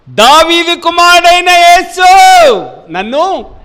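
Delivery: slow, very loud, high shout for mercy; crying out. Acted. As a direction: cry out desperately to Jesus. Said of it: "దేవుని వాక్యం సెలవిస్తుంది".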